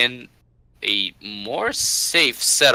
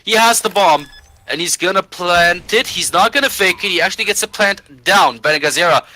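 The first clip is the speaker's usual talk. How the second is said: esports caster; 7 semitones higher, 4 dB louder than usual. Real, not acted. Clutch round play-by-play hype.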